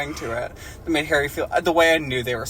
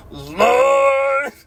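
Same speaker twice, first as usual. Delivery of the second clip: high, loud, slow bellowed plea; bellowing prayer; 9 semitones higher, 5 dB louder, much slower than usual. Acted. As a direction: belt it out like a preacher begging for food. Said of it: "Dumbledore bellowed in a voice even louder".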